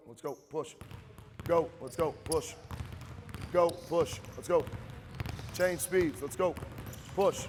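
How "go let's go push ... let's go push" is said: loud, higher drill calls; barking gym coach. Real, not acted. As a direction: shout them down the court. Coach calling drill to players.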